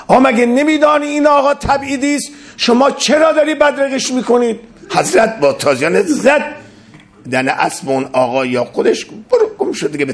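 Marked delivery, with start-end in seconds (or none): 0.1-4.6 high, fast, indignant demand; officious challenge. Acted. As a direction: guards snapping at the farewell party.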